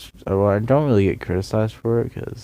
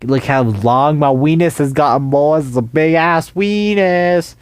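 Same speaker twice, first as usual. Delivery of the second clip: mock loud boast; 6 semitones higher, 8 dB louder than usual. Boasting loudly to the class.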